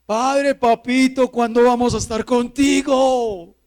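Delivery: loud, high pleading to a father; impassioned shouted prayer; crying out to God. Acted. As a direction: call up to heaven, begging for an answer. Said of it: "así es que clamamos".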